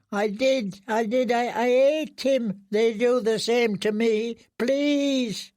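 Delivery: high, halting, stuttered I did, I did; frightened stammering confession. Acted. Prisoner confessing to eating the rat, high stammering plea. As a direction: stammer out a guilty plea for mercy. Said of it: "he mumbled".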